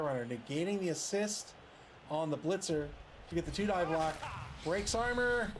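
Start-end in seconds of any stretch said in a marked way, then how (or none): 4.6-5.5 excited. Real, not acted. Armor break in play.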